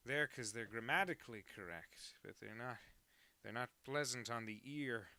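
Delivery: lightly put-on grumbling narrator in a recording booth; hammed-up complaint to the fellas about the script; bad Orson Welles. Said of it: "that was a really bad Orson Welles".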